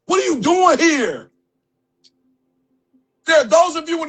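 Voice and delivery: fiery motivational preaching; preaching at full force. Real, not acted. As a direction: drive it home to the room like a sermon.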